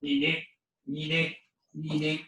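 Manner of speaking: lightly voiced frog calls; frog croak call. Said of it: "she hears this sound".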